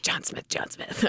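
whispering a name over and over to memorise it; whispered repetition. Retold or imitated. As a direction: mutter the name softly to yourself so you won't forget.

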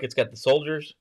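ordinary talk, nothing marked

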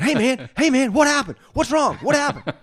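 high, loud, frantic concern; worried coworker barging into the bathroom, high and loud; alarmed buddy. Retold or imitated. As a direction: burst in panicking for your friend. Buddy Mark bursts in worried.

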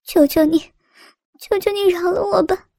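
high, crying plea; tearful pleading. A narrator's reading. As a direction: beg for mercy through tears. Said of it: "痛得眼泪夺眶而出".

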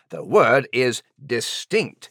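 distinct drawn out slowly; stress on distinct. Shown as a demonstration. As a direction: spell out distinct for Max, slowly. Correcting Max slowly.

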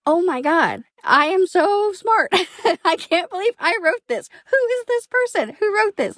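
high, giddy and thrilled with herself, with a laugh; giddy self-praise. Retold or imitated. Rereading her own draft, amazed at herself: giddy high gushing. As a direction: gush over your own genius, half joking. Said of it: "I'd be like".

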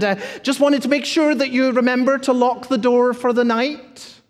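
the pastor plays himself fussing at the house sitter, chirpy; fussy phone caller. Retold or imitated. A bright, over-eager caller checking in too soon. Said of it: "you hear my voice".

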